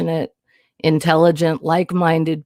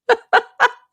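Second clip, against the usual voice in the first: another high giggling burst; high giggle; 4 dB louder than usual. Real, not acted. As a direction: let the giggle take over. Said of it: "Okay, I'm done".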